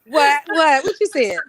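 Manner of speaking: loud, high disbelieving what; shocked demand to know; surprised. Real, not acted. Demanding to hear the remark.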